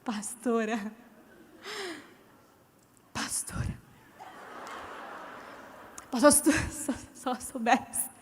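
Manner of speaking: fading to a whisper with laughter; embarrassed stalling. Retold or imitated. Stalling before the pastor out of shame, fading whisper. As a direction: trail off unable to answer.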